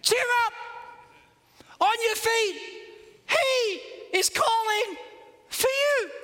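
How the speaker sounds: very high, called-out encouragement; acted cheering on. Crowd now urging the blind man up, high excited calling. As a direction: call it out high in separated bursts. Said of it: "are now saying".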